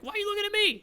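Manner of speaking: high squeaky quoted voice; mock ogress whine. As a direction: squeak it out, offended. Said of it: "She's like".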